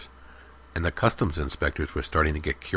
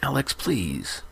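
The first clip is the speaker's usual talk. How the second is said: pleading; 7 semitones higher than usual. A narrator's reading. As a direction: beg him to stop. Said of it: "pleaded Lehman".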